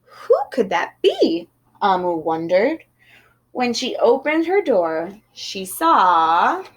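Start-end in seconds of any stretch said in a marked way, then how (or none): none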